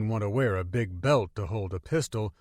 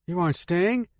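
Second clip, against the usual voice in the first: surprised; 7 semitones higher, 4 dB louder than usual. Acted. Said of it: "she said with surprise".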